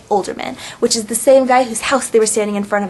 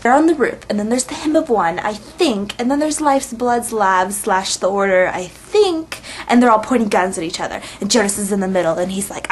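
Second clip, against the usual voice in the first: ordinary talk, nothing marked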